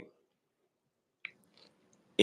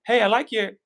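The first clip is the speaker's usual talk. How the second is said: friendly compliment; 11 semitones higher than usual. Retold or imitated. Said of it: "that compliment of".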